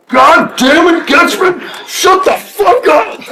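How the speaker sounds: retold angry coach, shouted high; coach yelling. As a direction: bark the coach's rebuke, laughing at it. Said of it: "He's like".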